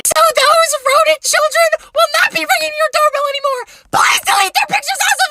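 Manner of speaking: very high, squealing mom impression; mock the mom in a shrieking squeal; angry mom. Mom messaging about her kids' pictures, mocking squeal. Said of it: "their mom D M'd me".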